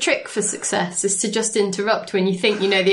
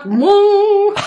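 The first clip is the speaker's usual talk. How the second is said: buzzer whoop; 11 semitones higher, 7 dB louder than usual. Retold or imitated. Imitating a buzzer sound.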